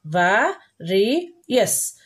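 va, re, yes as separate syllables; "va", "re", "yes", each on its own. Shown as a demonstration. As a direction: break "various" into va, re, yes. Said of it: "We will pronounce it as various".

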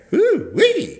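high whooping yell; woo-wee. Real, not acted. Hyped cowboy host whooping at his viewers.

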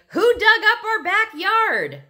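loud, high-pitched indignant yell; outraged outcry. A narrator's reading. Peter imagines the owners discovering the yard, outraged shout.

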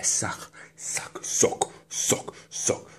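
whispered, repeated urging; aroused whisper. Acted. Urging the ear on, aroused whisper. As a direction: whisper each word as a separate command.

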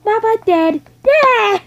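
put-on high, loud baby talk. Baby figure arguing with daddy, high loud babyish talk. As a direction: play a little child protesting.

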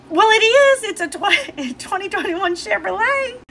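high, insistent mock husband voice; imitating husband. Wife mimics husband insisting, high and sure. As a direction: squeak it out like he's sure.

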